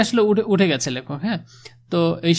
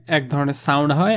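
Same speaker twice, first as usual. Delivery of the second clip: ordinary talk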